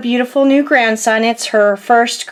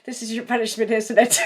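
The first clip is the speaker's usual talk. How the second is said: soft laughing tease; 7 dB quieter than usual. Retold or imitated. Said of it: "it was like".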